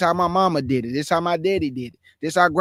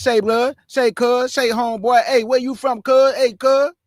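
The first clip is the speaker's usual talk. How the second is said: louder, higher, mocking street talk; street slang voice; 5 semitones higher, 4 dB louder than usual. Preacher imitating street talk to his congregation, mocking. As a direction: toss off the slang calls like a caricature. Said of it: "don't talk like this".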